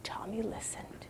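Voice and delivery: soft hushed narration; whispering. A narrator's reading. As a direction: whisper the pause gently.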